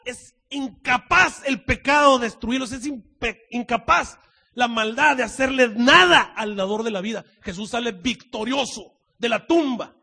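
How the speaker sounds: loud, high, drawn-out preaching; fervent preaching. Acted. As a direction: proclaim it with full force. Preaching at full force. (predicación fervorosa y fuerte)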